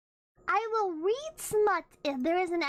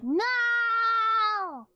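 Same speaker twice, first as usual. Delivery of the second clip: mock yell; 5 semitones higher, 5 dB louder than usual. [name] refusing, acted.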